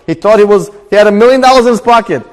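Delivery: loud, high storyteller's exclamation; emphatic climax. Real, not acted.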